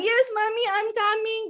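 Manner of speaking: put-on high, eager little-child voice; child's reply. Orion answers Mami's call, high eager child voice. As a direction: answer like an obedient small kid. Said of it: "Orion akan bilang".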